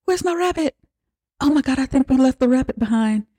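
put-on high, fast little girl panicking over her rabbit; a little girl upset about her lost toy rabbit; whiny little girl. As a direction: squeak it out like a frantic kid. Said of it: "She's like".

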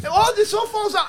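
high, laughing protest; helpless high-pitched amusement. Real, not acted. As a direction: squeal it through laughter. Laughing over the retold disaster.

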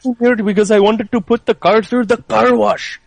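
imitating an Indian movie character; quoting the guy in a lilting Indian accent. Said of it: "Indian guy was like".

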